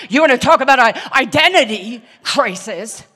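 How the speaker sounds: loud mocking exclamation; loud exclaim. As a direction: throw it out to the crowd. Mocking confusion, preaching.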